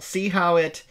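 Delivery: ordinary talk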